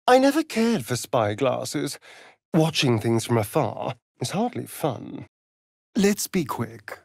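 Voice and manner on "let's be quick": usual speaking voice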